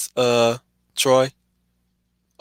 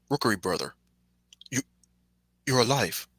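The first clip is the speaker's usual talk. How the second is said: Cold Stone voice; 8 dB quieter than usual. Retold or imitated. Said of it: "he was the voice of Cold Stone".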